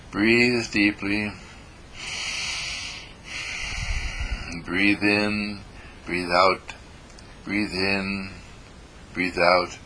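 slow, calm instruction; guided breathing. Shown as a demonstration. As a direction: pace their breath gently. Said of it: "guiding the breathing".

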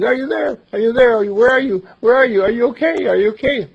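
loud, high calls down into the dark; calling out. Acted. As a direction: shout down the cliff, desperate to hear him. Calling down the cliff.

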